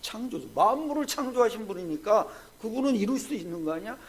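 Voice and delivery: ordinary delivery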